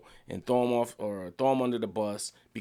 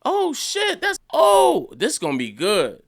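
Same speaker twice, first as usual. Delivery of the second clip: very high, loud squeal of delight; thrilled shock; 14 semitones higher, 10 dB louder, slower than usual. Retold or imitated. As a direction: squeal like the best twist just landed. Retold thrilled reaction.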